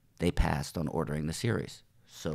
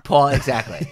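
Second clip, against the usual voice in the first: loud burst of laughter through the words; laughing; 6 semitones higher, 13 dB louder than usual. Real, not acted. Guest laughing at the host's Paul Escobar slip, loud laugh. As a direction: crack up as you agree.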